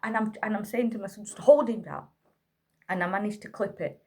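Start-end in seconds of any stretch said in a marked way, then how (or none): 1.2-2.1 louder, urgent retold command; raised-voice instruction; urgent order. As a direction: call out for help over a screaming child. Urgent order to son over screaming.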